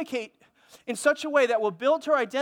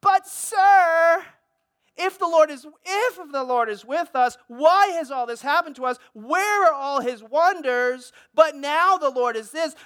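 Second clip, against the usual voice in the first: louder, higher desperate protest to the Lord; Gideon pleading; 5 semitones higher, 7 dB louder, slower than usual. Retold or imitated. Pastor voicing Gideon questioning the angel, loud pleading protest. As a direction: cry out the complaint like a man who feels abandoned. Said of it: "He said".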